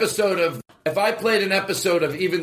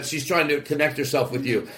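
ordinary talk

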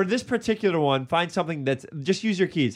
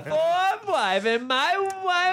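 high, slow, hammed-up Borat accent; 10 semitones higher, slower than usual. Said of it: "you turned into Borat".

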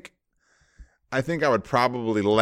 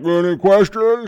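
mock host voice; 11 semitones higher, 10 dB louder than usual. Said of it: "you're like".

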